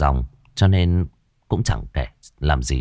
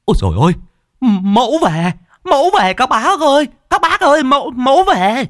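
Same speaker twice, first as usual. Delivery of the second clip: much higher, louder panicked cry; frantic yell; 5 dB louder than usual. Retold or imitated. Disciple yelling to the elders that Mẫu has arrived, high frantic shout. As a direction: shriek that the spirit has come. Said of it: "Tao nghe có thằng Khứa còn la lên".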